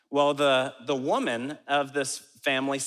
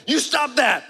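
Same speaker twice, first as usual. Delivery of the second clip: high, loud telling-off; hammed-up high-pitched reprimand; mock scolding; 11 semitones higher, 7 dB louder than usual. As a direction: scold loudly as a pretend enforcer. Imagined feeble scolding.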